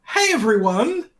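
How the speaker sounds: bright high hey; excited greeting. Acted. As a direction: sing out the greeting. Show greeting call.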